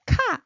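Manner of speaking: 카 aspirated, high and loud; 카 with a strong puff of air. Shown as a demonstration. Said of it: "stronger one, rising".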